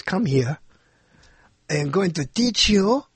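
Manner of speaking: mock French accent, word by word; stupid French accent. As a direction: imitate a Frenchwoman, deliberately silly French accent. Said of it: "this stupid French accent".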